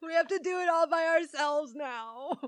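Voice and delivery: high, laughing mock sob; mock crying parents. Parents after Dora leaves for school: high, laughing mock sobbing. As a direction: wail it high like fake tears. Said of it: "They're like".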